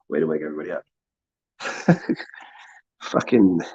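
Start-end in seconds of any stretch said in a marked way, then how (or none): 0.1-0.8 low, soft sarcastic praise; mock deep voice. Congratulating the wake-up crowd, deep muted sarcasm. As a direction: drop deep and quiet, sarcastically.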